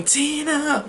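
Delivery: high, drawn-out wail without volume; mock bawling scream. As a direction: imitate a child screaming a name, held back. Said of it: "starts bawling, screaming".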